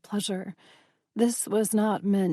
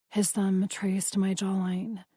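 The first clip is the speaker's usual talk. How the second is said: ordinary delivery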